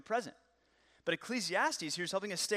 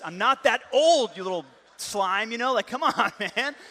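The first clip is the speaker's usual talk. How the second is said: louder, hammed-up indignant outburst; mock outrage; 5 semitones higher, 11 dB louder than usual. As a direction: play the offended dad, half laughing. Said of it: "And I was like".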